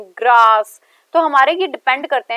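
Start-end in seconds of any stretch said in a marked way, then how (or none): 0.0-0.8 grass said louder with a long "aa"; stressed vowel. Shown as a demonstration. Teacher repeating grass with its vowel stressed out. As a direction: lean on the a in grass. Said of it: "ए को stress out करते हैं".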